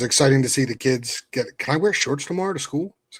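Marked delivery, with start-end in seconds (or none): 1.6-3.0 lightly voiced eager child, pitched higher; a hint of a kid asking hopefully; excited kid. Kid asking parent about shorts for school, lightly voiced excited child.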